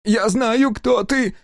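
high, eager little man's voice; excited old engineer. A narrator's reading. Engineer gloating at Sega, high and eager. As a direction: crow it out high and thrilled.

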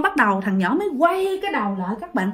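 ordinary delivery, nothing marked

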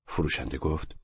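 ordinary delivery

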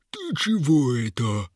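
slow, puzzled monster voice; amused troll. A narrator's reading. Troll to guard tying his foot, slow amused creature voice. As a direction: ask it like a dim, amused troll. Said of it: "захохотал тролль".

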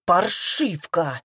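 slow, drawn-out angry word; mildly barked, stretching the insult. A narrator's reading. Witch outraged by Glenna's accusation, mild bark. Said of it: "рявкнул я".

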